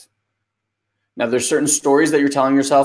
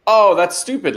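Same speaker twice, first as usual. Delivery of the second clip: dismissive dad; 7 semitones higher, 4 dB louder than usual. Retold or imitated. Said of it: "I'm not one of those dads that's like".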